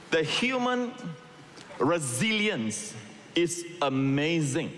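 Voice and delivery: slow, stretched preacher emphasis; drawn-out emphasis. Real, not acted. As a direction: draw out resilience for weight.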